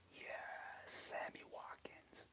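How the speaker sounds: slow, breathless whispered cheer; a hidden cheer only she hears; secret whisper. Pretend. Mom secretly cheering her fantasy player inside, a slow whisper.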